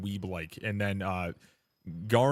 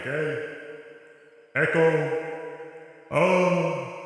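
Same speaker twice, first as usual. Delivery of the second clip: echoing like in a cave; 6 semitones higher, 4 dB louder, much slower than usual. Shown as a demonstration. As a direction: call out into a cave echo. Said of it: "I can talk like I'm in a cave".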